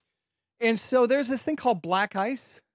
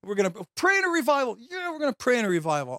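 mock eager; 7 semitones higher than usual. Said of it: "So some of the fourth".

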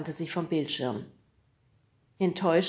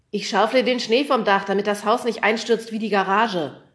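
loud, raised voice from up high; calling down from roof; 4 semitones higher, 8 dB louder than usual. A narrator's reading. Kriegelstein shouting down to the boys below, loud. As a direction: call it down from the roof.

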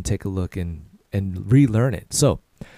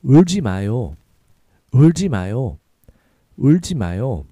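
quicker natural pace; a little faster; 4 dB louder, much faster than usual. Shown as a demonstration. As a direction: say it at near normal speed. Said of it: "A little faster".

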